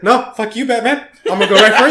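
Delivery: high, loud, jeering enemy goon voice; mock enemy taunt. Enemy thug mocking Batman, high jeering taunt. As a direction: play the shield guy gleefully picking on Batman. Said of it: "it's like".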